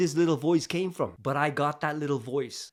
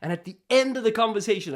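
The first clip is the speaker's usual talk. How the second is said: ordinary delivery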